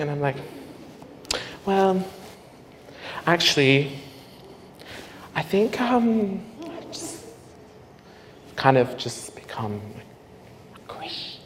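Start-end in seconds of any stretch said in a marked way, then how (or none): 1.6-11.4 soft, halting, fading to nothing; stall, then lose the word in a whisper; hesitant confession. Retold or imitated. Son confessing conversion to his mum, hesitant and fading.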